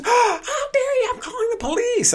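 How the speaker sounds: hammed-up hysterical shriek, sky-high pitch; loud falsetto outrage, acted out; mock screaming. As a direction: imitate her screeching a threat. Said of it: "as she's screaming".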